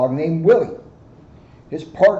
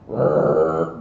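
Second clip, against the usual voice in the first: engine rumble. Acted. Car zooming sound.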